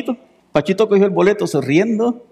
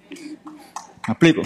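whisper; 6 semitones lower, 4 dB quieter, much slower than usual. Real, not acted. Aside to congregation.

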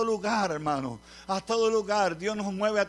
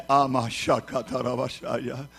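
lower, slow ecstatic tongues; speaking in tongues; 8 semitones lower, slower than usual. Real, not acted. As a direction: let the tongues flow out slowly. Speaking in tongues mid-sermon. (hablando en lenguas, grave y lento)

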